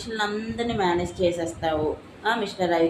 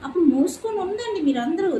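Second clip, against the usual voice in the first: angry shout; 9 semitones higher than usual. Real, not acted. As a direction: snap at all of them to shut up. Angry rant at relatives.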